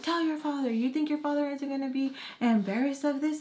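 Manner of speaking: high scolding mother; scolding mom voice. Retold or imitated.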